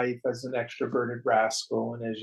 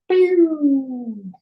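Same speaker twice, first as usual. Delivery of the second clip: high, loud playful pew; pew sound effect; 13 semitones higher, 11 dB louder than usual. Retold or imitated. The body dropping off at death, high playful pew noise. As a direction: pop the sound out like something flying off.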